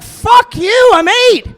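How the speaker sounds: high, loud mock defiance; kid talking back. Paperboy yelling back at the neighbour, high mock defiance. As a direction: snap back like an eight-year-old. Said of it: "It's like".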